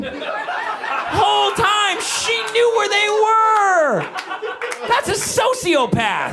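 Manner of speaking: high, stretched-out comic yell; hammed-up, high-pitched exasperation; outraged rant. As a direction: wail it in disbelief. Father ranting about child's deceit.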